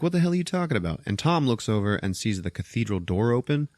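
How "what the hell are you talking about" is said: high-pitched exasperated retort; mock exasperation. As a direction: act out an incredulous comeback. Voicing Jenny's exasperated reply.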